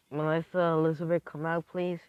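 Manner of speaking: high, loud summons; acted call to someone in another room. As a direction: call them out to join you. Acted calling out to girls.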